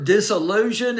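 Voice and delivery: ordinary delivery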